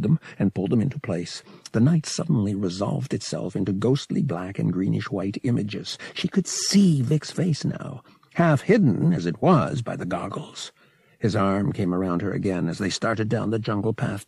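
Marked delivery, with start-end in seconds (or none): none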